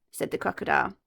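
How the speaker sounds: ordinary delivery, nothing marked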